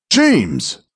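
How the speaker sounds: high-pitched urgent cry. A narrator's reading. Arcturus crying out for a lost companion in the dark, urgent cry. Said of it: "Arcturus cried".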